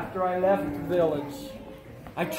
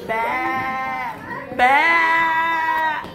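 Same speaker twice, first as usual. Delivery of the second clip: noisy sheep bleat; 5 semitones higher than usual. Retold or imitated. Storyteller imitating the gathered sheep, noisy bleating. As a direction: bleat like a restless flock, building. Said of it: "they were kinda noisy".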